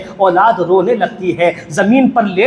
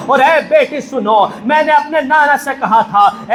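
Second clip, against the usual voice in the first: preaching at full force; 4 semitones higher than usual. Acted. Preacher voicing the Imam's plea to his daughter, fervent and raised.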